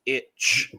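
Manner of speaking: "itch" with a clear ch ending; pronunciation demo. Shown as a demonstration. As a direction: say "itch" as a sound example, ch drawn out. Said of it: "it is pronounced like".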